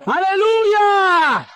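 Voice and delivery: high, long-held praise cry; exultant hallelujah. Real, not acted. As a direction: stretch the hallelujah out, pitched high. (aleluya jubiloso, agudo y muy prolongado)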